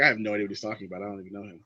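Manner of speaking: low, quiet, hurried denial; feigned ignorance. Acted. Actor pretending not to know the man, low dismissive denial. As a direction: deny it under your breath, dropping away. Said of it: "He acts like he doesn't know".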